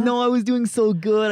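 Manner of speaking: retold frustrated protest; exasperated outburst. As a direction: cry out like your plan just collapsed. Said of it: "I'm like".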